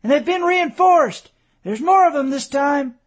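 high, loud alarm call. A narrator's reading. Young soldier in a tree warns the colonel, loud and high. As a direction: shout the warning down from the treetop. Boy calls warning from tree.

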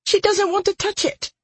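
very high, small voice; reluctant girl. A narrator's reading. Captive girl refusing the magic, high and small. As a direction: refuse in a tiny girlish voice.

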